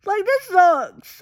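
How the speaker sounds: high, whiny fake sob; hammed-up wail over a sad scene; a playful hammed-up sob of heartbreak; mock crying. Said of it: "So I'm like".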